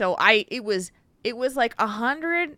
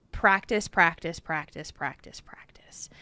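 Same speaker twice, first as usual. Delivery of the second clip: fading to a whisper; 2 semitones lower, slower than usual. Pretend. Repeating practice until it fades out. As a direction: let the word die away into breath.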